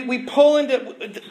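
normal talking voice

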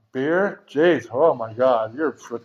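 ordinary talk, nothing marked

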